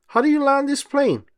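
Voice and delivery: high, panicky cry for help; a worried pilot's yelp; panicked question. Acted. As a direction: squeak it out in alarm. Mater panicking in the air.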